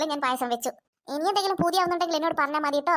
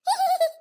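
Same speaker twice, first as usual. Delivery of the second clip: high mischievous laugh; tricky little laugh; sly giggle; 5 dB louder than usual. Acted. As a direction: cackle at your own plan. Mother plotting a trick.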